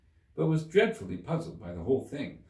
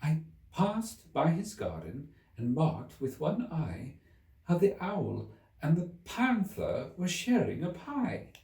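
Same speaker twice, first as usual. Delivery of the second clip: trembling; 7 semitones higher, slower than usual. Acted. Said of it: "in a trembling voice".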